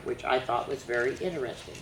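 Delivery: plain reading voice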